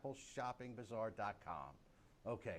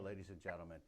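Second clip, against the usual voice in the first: ordinary talk, nothing marked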